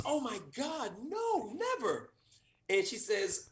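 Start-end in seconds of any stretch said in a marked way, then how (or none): 0.1-2.1 agent. Retold or imitated. Agent, high and softer.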